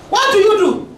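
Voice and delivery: normal talking voice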